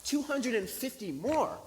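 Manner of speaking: high, loud, drawn-out disbelieving question; incredulous echo. Retold or imitated. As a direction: repeat it back in exaggerated astonishment. Astonished echo of the request.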